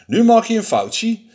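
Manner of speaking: a hint of a cocky little mouse; lightly voiced boastful mouse. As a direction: bluff confidently as the small mouse.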